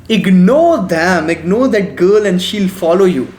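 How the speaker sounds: ordinary delivery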